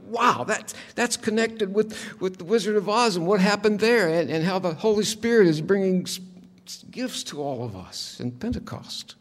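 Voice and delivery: high, excited burst of wonder; amazed realization. Retold or imitated. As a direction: gasp out the discovery, eyes wide.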